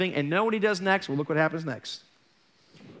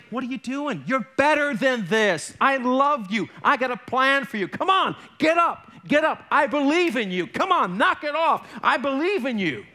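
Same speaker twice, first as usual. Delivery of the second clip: God encouraging; 6 semitones higher than usual. Retold or imitated. God urges the fallen believer, warm raised pleading. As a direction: lift him up like a loving father. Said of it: "You know what God would say".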